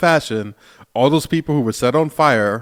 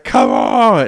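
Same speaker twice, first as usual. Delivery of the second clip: loud imitated yell, drawn out; retold scream; 6 dB louder than usual. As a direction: imitate him yelling a challenge at the dragon. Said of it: "he screams at him".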